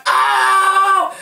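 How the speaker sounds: high, loud shocked scream. Retold or imitated. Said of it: "I was over here screaming like".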